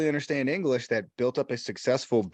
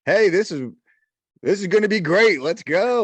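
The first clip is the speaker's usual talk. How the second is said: higher and louder, hyped investor voice; pumped-up quote; 7 semitones higher, 7 dB louder than usual. Retold or imitated. Podcast host acting out the guest's hype over buying a motel, excited. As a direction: sell the big plan with a grin you can hear. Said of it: "And you're like".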